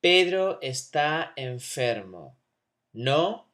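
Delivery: slow "Pedro está enfermo" then a rising "¿no?"; tag question. Shown as a demonstration. Teacher to class, showing a tag question with rising tag. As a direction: pitch "¿no?" up sharply as a tag. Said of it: "Check this out".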